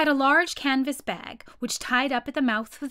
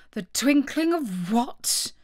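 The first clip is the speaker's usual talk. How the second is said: slow royal query; measured, questioning king; puzzled king; 2 semitones higher, slower than usual. A narrator's reading. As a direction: ask slowly, as a baffled judge.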